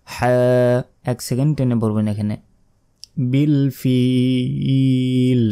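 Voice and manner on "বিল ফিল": বিল ফিল with ফিল held for three seconds; stretched বিল ফিল; long final vowel. Shown as a demonstration. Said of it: "শেষে তিন second".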